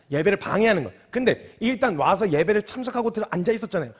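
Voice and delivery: normal talking voice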